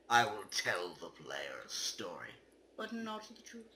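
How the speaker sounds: hushed, secretive whisper; spooky whisper. Acted. Reading the game's ending poem aloud, in a whisper. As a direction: breathe it like a secret.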